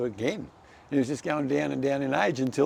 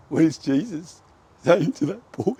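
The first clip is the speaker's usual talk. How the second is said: choked up; 5 semitones higher, 6 dB louder than usual. Real, not acted. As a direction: voice high and loud, breaking with emotion. Tearful testimony of healing.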